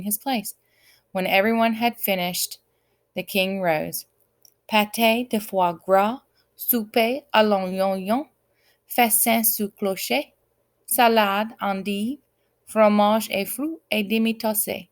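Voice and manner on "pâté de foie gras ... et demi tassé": slow, careful French menu; lightly French, each dish savoured. A narrator's reading. King reciting his French menu carefully. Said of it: "he said carefully".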